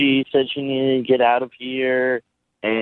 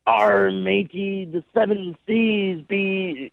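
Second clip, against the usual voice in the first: slow, higher-pitched mock pirate; 7 semitones higher, slower than usual. Shown as a demonstration. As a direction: draw it out like a jokey pirate. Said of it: "to talk in a pirate voice".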